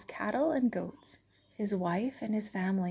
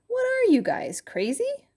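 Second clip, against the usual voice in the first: incredulous; 5 dB louder than usual. Acted. Voice mocks the friends.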